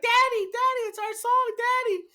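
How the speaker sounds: put-on very high squealing kid voice; excited little daughter. Daughter hears the duet start, squealing with excitement. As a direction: imitate a thrilled child calling Dad. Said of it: "she gets so excited".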